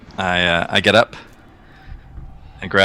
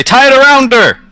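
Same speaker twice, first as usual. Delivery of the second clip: urgent shout; 7 dB louder than usual. Pretend. Urgent rescue, shouting to wife.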